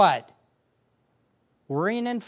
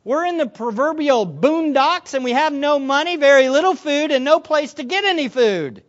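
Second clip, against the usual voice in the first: loud, high-pitched complaint; exasperated protest; 7 semitones higher, 7 dB louder than usual. Acted. Disciples protesting the impossible command, exasperated and loud. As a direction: pile up objections with raised voice. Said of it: "they were thinking something like this".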